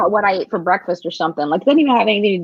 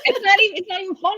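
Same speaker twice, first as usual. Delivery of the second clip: laughing; 12 semitones higher, faster than usual. Real, not acted. Laughing along with her friend.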